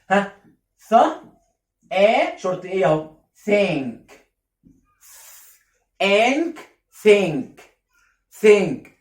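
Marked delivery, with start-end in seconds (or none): 0.9-0.9 "ث" with tongue between the teeth; TH like Arabic tha. Shown as a demonstration. Teacher sounding out think for learners, voiceless th. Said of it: "زي حرف الثاء". 3.5-8.9 "Think" with a voiceless tongue-out th; TH demo. Shown as a demonstration. Teacher drilling think with tongue out, clear th. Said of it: "لازم تخرج فيهم لسانك".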